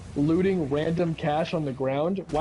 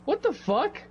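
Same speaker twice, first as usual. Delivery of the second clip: high, squeaky cry of disbelief; incredulous outburst; 9 semitones higher than usual. Retold or imitated. Watching a stream, baffled by a money pop-up, high incredulous cry. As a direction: yelp at a pointless pop-up. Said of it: "and I was like".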